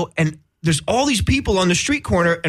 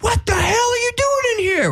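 firefighter yell. Retold or imitated. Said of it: "the firefighters are like".

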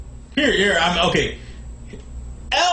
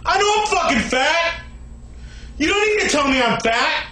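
angry rant. Acted. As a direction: yell furiously at the haters. Ranting at the haters in comments.

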